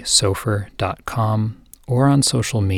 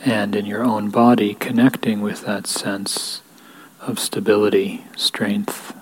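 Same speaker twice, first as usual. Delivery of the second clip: guided meditation. Real, not acted. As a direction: lead them gently into stillness, unhurried, leaving space between phrases. Leads a guided equanimity meditation.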